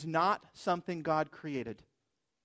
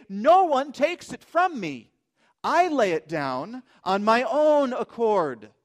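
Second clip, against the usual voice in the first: loud, raised, slow proclamation; emphatic declaration; 6 semitones higher, 9 dB louder than usual. Acted. Preacher quoting Jesus laying down his life, loudly emphatic. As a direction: proclaim it with deliberate force on every phrase.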